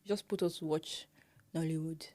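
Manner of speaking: ordinary talk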